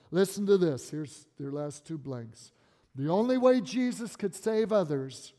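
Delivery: ordinary delivery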